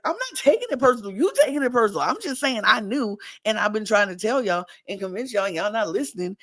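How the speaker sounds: high, fast protest; mock defensiveness. [name] arguing back at Joe, mock defensive. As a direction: argue back quickly, imitating indignation.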